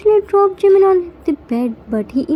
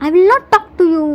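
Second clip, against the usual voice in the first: higher-pitched, lightly acted shout; mild shout; 4 semitones higher, 4 dB louder, much faster than usual. A narrator's reading. Jimin shouts at Yoongi from the corner, mild shout. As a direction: call it out in a mock sulky yell. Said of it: "Jimin shouts".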